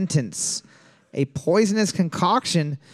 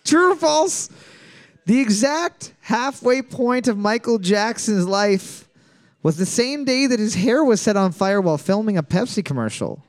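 quiz host read; 4 semitones higher than usual. Acted. Host reads question, high.

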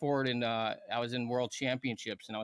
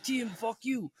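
Russian guy's curse; 7 semitones higher, 4 dB quieter than usual. Retold or imitated. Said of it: "He's like".

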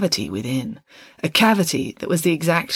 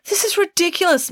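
much higher, indignant outburst; exclaimed. A narrator's reading. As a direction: blurt it out, outraged. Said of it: "Mister Fredericks exclaimed again".

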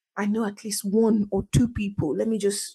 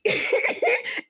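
squeaky rising laugh; high giggle. Real, not acted. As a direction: break into a giggle. Laughing about iced Pepsi.

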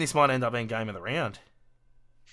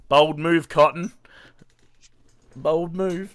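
movie quote; 5 semitones higher, 10 dB louder, slower than usual. Retold or imitated. Quoting movie line.

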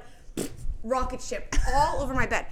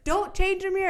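told-you-so husband; 8 semitones higher than usual. Retold or imitated. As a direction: mimic a smug husband, pitched up. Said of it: "Chris is like".

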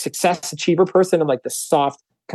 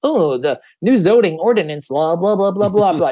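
lightly voiced dull nerd, droning on; mock nerd voice; 3 semitones higher than usual. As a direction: brush it off like a boring nerd reciting facts. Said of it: "the nerds, facts and figures".

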